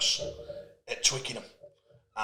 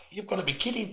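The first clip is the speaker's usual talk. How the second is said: higher, hushed mock horror; shocked disbelief; 6 semitones higher, 5 dB quieter, faster than usual. Imitating Americans hearing the yearly wage, shocked disbelief. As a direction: say it softly aghast, imitating disbelief. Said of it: "the shock and horror on their faces".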